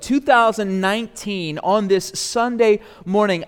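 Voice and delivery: high, forceful sermon delivery; preaching. Real, not acted. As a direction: proclaim it to the congregation with full conviction.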